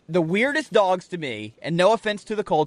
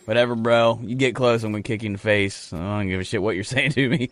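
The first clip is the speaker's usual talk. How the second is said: lower, dismissive, couldn't-care-less cow; surly meat cow; 4 semitones lower than usual. Pretend. A meat cow shrugging off its handler, low and dismissive. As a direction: brush off the farmer like a bored tough guy. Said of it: "they're like".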